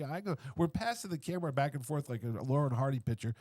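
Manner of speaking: normal talking voice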